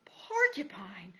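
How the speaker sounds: whispered, slow, numb with fear; barely breathing the word; terrified whisper. Acted. Mouse gasps in terror.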